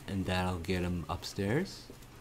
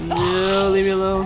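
panicked yell; 10 semitones higher, 11 dB louder than usual. Real, not acted. Chased by the pursuer.

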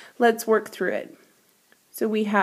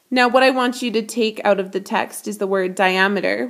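ordinary talk

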